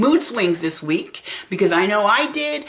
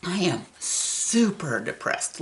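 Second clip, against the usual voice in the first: ordinary talk, nothing marked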